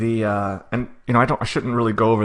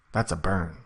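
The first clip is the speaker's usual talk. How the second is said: quiet repeat; 5 semitones lower, 6 dB quieter than usual. Shown as a demonstration. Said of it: "You gotta say it slowly enough".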